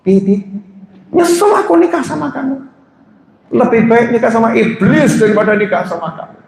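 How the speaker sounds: high mock-whiny echo of the wife; husband echoing wife in high mock voice; mocking wife's complaint. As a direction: repeat her words back in a high teasing voice. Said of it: "suaminya diem terus noleh".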